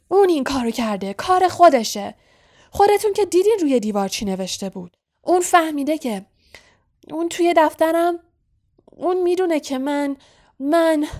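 loud, high, agitated accusation; angry. A narrator's reading. Caretaker blaming Harry for his cat, angry outburst. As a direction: rage at them, faltering at the end. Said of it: "با عصبانیت گفت".

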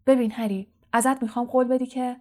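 usual speaking voice

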